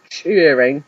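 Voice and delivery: ordinary delivery, nothing marked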